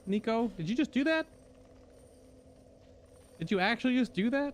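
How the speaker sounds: high-pitched disbelieving question; incredulous. Real, not acted. Streamer questioning Nico's wording, incredulous. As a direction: call someone out, voice leaping up in disbelief.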